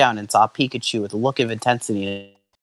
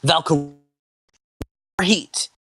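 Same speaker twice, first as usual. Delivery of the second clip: mild shout; 5 semitones higher, slower than usual. Acted. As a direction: shout a move with restraint. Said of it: "Alder shouted".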